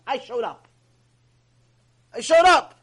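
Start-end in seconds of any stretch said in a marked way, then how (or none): 2.1-2.7 much louder, higher insistence; loud, raised declaration; emphatic repeat. Retold or imitated. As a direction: hit it hard, almost shouting.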